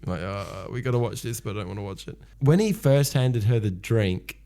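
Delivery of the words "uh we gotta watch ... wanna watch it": trailing off much softer; awkward reluctance. Acted. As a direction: murmur it like an uncomfortable onlooker. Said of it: "They're awkward".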